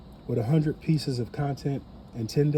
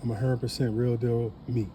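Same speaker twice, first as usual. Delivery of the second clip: usual speaking voice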